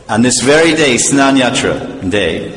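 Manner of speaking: normal talking voice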